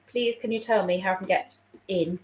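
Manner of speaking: usual speaking voice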